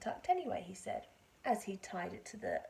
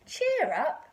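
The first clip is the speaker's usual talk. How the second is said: hearty cheer; 6 semitones higher, 12 dB louder than usual. Acted. George comforts dog.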